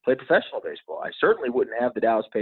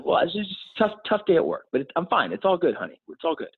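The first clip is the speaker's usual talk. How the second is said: higher-pitched mother playing it down; retold motherly reassurance in a raised pitch; reassuring mom, imitated; 8 semitones higher than usual. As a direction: lightly voice a mom soothing her child, pitched up. Mom playing down her tears to son.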